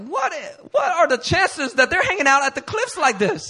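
high-pitched incredulous protest; acted disbelief. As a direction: argue the odds in a high voice. Acted disbelief at being spotted.

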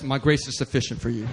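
lightly voiced God, low and even; God's reply. God answering a preacher's plea, slightly solemn low voice. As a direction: answer with calm, low authority. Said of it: "And God says".

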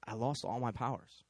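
low, soft, defeated admission; really sad. Acted. Sad warlock confessing to the woman, low and soft. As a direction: confess it quietly, sounding beaten. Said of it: "he was just really sad".

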